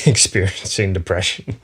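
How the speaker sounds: ordinary delivery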